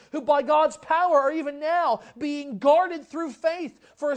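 loud, driving sermon delivery; rising fervent pulpit voice; full-force preaching. Acted. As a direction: preach it out to the whole room.

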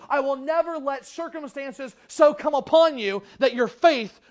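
loud, driving sermon delivery; rising fervent pulpit voice; full-force preaching. Acted. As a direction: preach it out to the whole room.

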